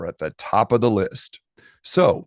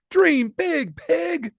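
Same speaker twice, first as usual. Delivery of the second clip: very high, squeaky cheer; squeaky best friend; much slower than usual. Acted. Best friend Emma cheering Poppy, very high and squeaky. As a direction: chirp it like an excited little friend. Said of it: "said Emma".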